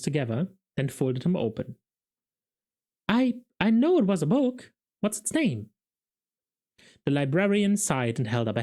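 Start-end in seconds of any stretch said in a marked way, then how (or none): 3.1-5.7 eager Carrot. A narrator's reading. Carrot guessing at charades.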